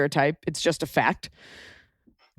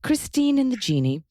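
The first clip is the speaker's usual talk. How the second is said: higher-pitched posh British reading; British RP accent; 7 semitones higher than usual. Shown as a demonstration. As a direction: read a listener's question in put-on RP. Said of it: "in a R P".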